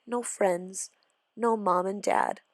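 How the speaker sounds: ordinary delivery, nothing marked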